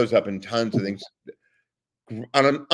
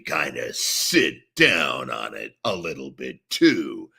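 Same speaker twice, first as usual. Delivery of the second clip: sitting down on the growl; 8 semitones lower, slower than usual. Shown as a demonstration. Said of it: "So you do that".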